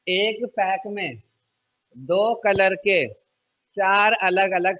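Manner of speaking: slow, word by word for copying; slow dictation. Shown as a demonstration. Teacher dictates to pupils for copying, slowly. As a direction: spell it out slowly so they can write. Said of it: "चलो copy करो उसको पहले".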